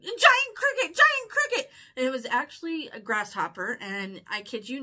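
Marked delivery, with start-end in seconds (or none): 0.0-1.7 very high, loud squeal of terror; panicked high-pitched wail; reenacted freak-out. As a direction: shriek a horrified alarm, retelling it. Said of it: "And I freaked out, freaked out, like, full on".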